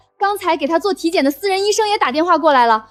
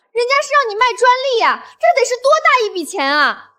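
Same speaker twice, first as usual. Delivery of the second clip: raised, exclaiming pitch; incredulous; 6 semitones higher than usual. Acted. Urging brother-in-law to sell the patent, incredulous. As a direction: exclaim at the missed fortune. Amazed he refuses huge money.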